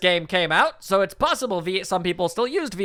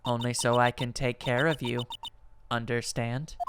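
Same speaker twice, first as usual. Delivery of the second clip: low, soft, menacing secretary voice; quiet threat, eerily calm; creepy secretary; 7 semitones lower, 8 dB quieter than usual. Acted. Secretary character voiced in game.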